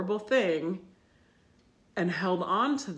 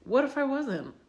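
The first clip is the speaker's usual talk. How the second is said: mock confession; 6 semitones higher, 5 dB louder than usual. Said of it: "I'm really owning it when I'm like".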